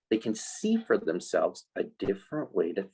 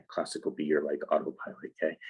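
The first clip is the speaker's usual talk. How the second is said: usual speaking voice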